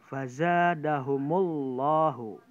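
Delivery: fazadahumullahu with a thick, heavy lam, slow and loud; heavy tafkhim lam. Shown as a demonstration. Teacher reciting a heavy lam example for the class, slow and loud. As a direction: draw out fazadahumullahu, darkening the lam. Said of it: "Itu tebal".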